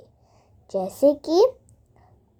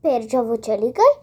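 usual speaking voice

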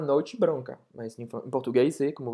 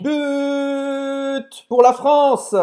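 mock goal cheer; 10 semitones higher, 9 dB louder, much slower than usual. Mock football chant.

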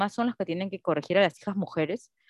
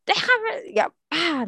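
retold annoyed mother; 8 semitones higher, much slower than usual. Mother shouting in retold quarrel.

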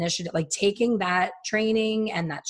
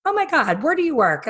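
high, louder excited "oh my God"; amazed recognition; 9 semitones higher, 5 dB louder than usual. Retold or imitated. Bud to stylist in a bar, high thrilled surprise.

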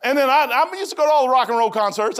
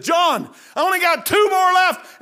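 slow, loud call across the hospital room, pitched high; calling out to John; 9 semitones higher, 6 dB louder, slower than usual. Retold or imitated. Preacher retelling how he called to John, mock shout. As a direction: shout it over to the bed. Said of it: "And I walk right back over there and said".

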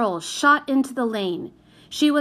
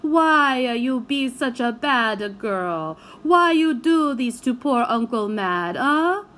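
pleading Italian uncle; 2 semitones higher, 3 dB louder than usual. A narrator's reading. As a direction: beg her why in broken Italian English. Uncle pleading with naughty girl.

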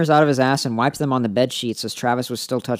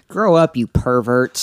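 louder, slow scolding; scolding shout; 9 dB louder, slower than usual. Real, not acted. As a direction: scold them slowly and loudly. Mock scolding listeners.

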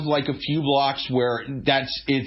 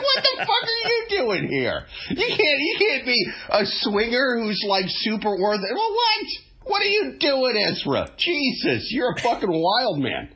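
high, laughing cop voice; exasperated cop; 7 semitones higher than usual. Retold or imitated. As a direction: play a fed-up cop.

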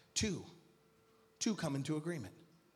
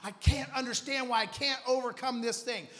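raised, pleading prayer shout; higher-pitched, hammed-up plea from someone beaten; defeated cry to God; 7 semitones higher, 5 dB louder than usual. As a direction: cry out to God in acted desperation. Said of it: "when you're defeated and you're like".

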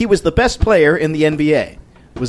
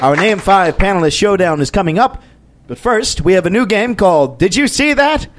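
raised, energetic show intro voice; game show announcer; 3 semitones higher than usual. Acted. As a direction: announce it big, stretching out the host's name. Show announcer opening the quiz.

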